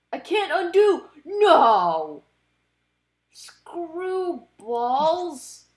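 loud, panicked yelps at the screen; frustrated outburst. Real, not acted. Reacting to a misclick in the card game, loud dismay. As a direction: blurt it out in dismay.

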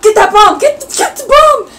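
loud, high frantic yell; excited shout. Real, not acted. Gamer yelling at her fighter to grab the bomb, frantic shout. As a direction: scream at the screen for the bomb.

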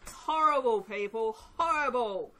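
loud, high groan of disgust; disgusted outburst. Real, not acted. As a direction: spit out the word in revulsion. Disgust at stinky soap.

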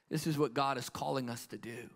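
normal talking voice

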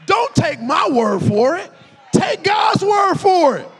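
loud, rising sermon cry; preaching at full force. Acted. Preacher urging the congregation to declare it aloud, full-force preaching. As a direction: preach it out to the crowd.

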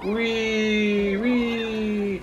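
high, gleeful wee; excited whee. Acted. Flying off the ramp in the race, high gleeful whee. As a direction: squeal like you're on a ride.

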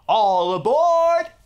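big drawn-out call for everyone aboard; conductor call. Shown as a demonstration. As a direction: shout it out with the kids like a conductor. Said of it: "You could say it with me".

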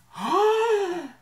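sharp shocked gasp, high and loud. Real, not acted. Vlogger realizing her audition is tomorrow gasps. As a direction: gasp in sudden panic.

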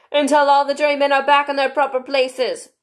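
high, loud, solemn ruling; grave King. A narrator's reading. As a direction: declaim it gravely as royal decree. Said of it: "in a very grave voice".

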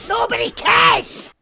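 high, drawn-out dismissive yell; exasperated shout. Real, not acted. Dismissing teammate's callout.

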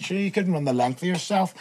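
mock a pupil as the teacher did, no real laugh; lightly imitated scoffing; laughing jeer. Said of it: "as he laughed and said".

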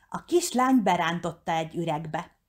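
ordinary delivery, nothing marked

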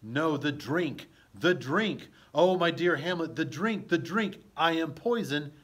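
loud, pleading cry of a poisoned queen; dying queen cry. Acted. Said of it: "The queen says".

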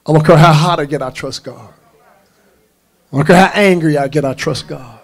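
loud opening, firm falling answer; forceful preaching declaration. Real, not acted. Preacher declaring trust whether hot, angry or sick, forceful preaching. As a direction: hammer each hardship, then settle on the trust.

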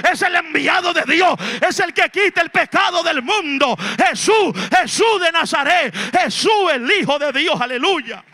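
loud, high-pitched Pentecostal sermon; full-force preaching. Real, not acted. Preacher proclaiming Jesus as Son of God to the congregation, full-force preaching. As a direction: proclaim it forcefully to the whole church.

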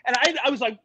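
ordinary delivery

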